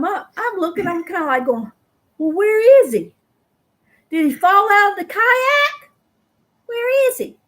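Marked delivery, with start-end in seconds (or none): 2.2-7.4 high, loud worried questions; worried calling. Retold or imitated. Wife wonders if her husband fell out of the kayak, high worried calls. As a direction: scan the river, anxious.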